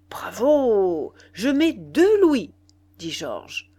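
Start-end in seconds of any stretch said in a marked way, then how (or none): 0.1-2.5 loud, drawn-out bidding cry; cheer, then raise the bet with gusto; enthusiastic cheer. A narrator's reading. Georges outbids his friend on Nana, enthusiastic.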